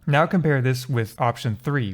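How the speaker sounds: ordinary talk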